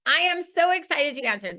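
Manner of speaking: loud, high, gushing "oh my gosh"; thrilled welcome-call hello; excited greeting. Shown as a demonstration. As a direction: burst with delight on the phone. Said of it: "I'm gonna say".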